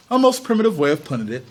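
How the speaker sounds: ordinary delivery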